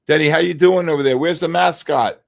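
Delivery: loud greeting. Real, not acted. Greeting chatter loudly.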